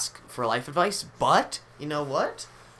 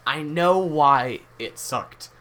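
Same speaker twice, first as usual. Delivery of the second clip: slow, emphatic; 3 semitones higher, 7 dB louder, much slower than usual. Acted. Dramatic realization.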